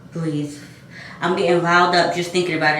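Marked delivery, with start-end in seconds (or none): 0.1-0.6 breathy exhale to calm down; deep sigh. Real, not acted. Calming herself after retelling the bird attack, sighing. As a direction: let the breath out slowly. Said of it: "I just".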